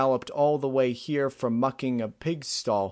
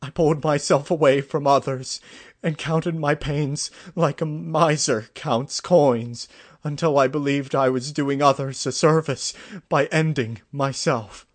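higher, loud, anguished outpouring; understated acted sobbing, voice raised; tearful confession; 5 semitones higher, 8 dB louder than usual. A narrator's reading. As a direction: pour out self-reproach, on the edge of sobs. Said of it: "said between heaving sobs".

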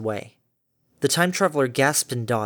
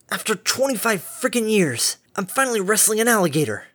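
high, thrilled yell of triumph; gleeful shout; 8 semitones higher than usual. Acted. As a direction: shout it out in wild delight. Gleeful cry while wrestling monster.

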